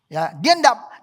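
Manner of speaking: ordinary delivery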